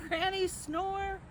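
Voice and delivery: usual speaking voice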